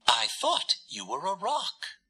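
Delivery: high, soft, fluttery bird voice; a shy little bird, still flustered; flustered dove. A narrator's reading. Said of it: "all aflutter".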